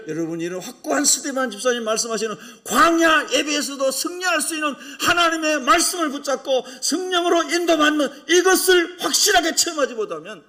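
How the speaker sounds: loud, high-pitched sermon delivery; forceful preaching. Real, not acted. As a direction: preach at full force to the congregation.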